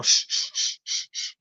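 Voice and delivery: soft unvoiced shush to quiet the others. Real, not acted. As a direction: hush everyone with a breathy shh. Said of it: "hold on, hold on".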